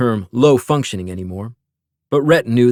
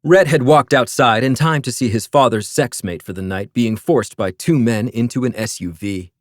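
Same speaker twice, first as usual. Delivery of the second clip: ordinary delivery, nothing marked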